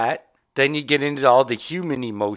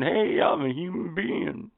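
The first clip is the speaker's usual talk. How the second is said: slow, softer mock greeting to the mirror; mock self-discovery; 2 semitones higher, 7 dB quieter than usual. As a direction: draw it out as if greeting your reflection. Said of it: "looking in the mirror going".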